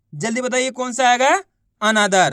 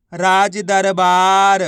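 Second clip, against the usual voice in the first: राज दरबार drawn out very slowly and louder; slow blended word; 3 semitones lower, 4 dB louder, much slower than usual. Shown as a demonstration. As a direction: stretch it out so children hear every sound. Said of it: "तो बताइए मिलके क्या बनेगा?". (धीरे धीरे खींचकर)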